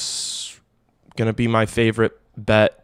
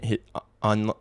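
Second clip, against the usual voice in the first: ordinary delivery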